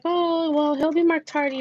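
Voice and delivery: high, airy imitation of an office lady; school office lady. Voices school lady on the phone.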